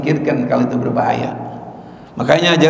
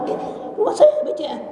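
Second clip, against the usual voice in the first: proud homeowner; 3 dB quieter, faster than usual. Acted. Mock reply, man near bank.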